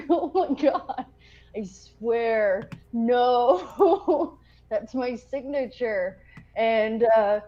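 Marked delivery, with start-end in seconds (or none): 0.1-6.2 high, giggling explanation; laughing protest. Retold or imitated. Recruit explaining signature to officer, laughing. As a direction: laugh while swearing it's nothing. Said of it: "And I'm like".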